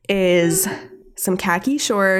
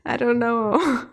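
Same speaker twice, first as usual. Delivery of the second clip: slow, higher-pitched mock uncertainty; unsure, reluctant mumble-along; hesitant; 5 semitones higher, much slower than usual. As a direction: drag it out, imitating a doubtful kid. Doug unsure about the look.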